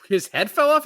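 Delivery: high, loud disbelieving rise; shocked echo, voice jumps up on off; surprised question. Retold or imitated. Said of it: "And, like".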